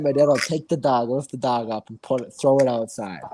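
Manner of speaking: normal talking voice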